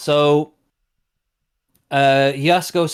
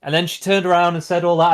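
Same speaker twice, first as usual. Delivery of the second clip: ordinary talk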